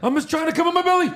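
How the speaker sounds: high-pitched, rushed mock quote; Kevin. Riffing on a wrestler's shirt, high, hurried mock voice. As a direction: blurt it out high and fast.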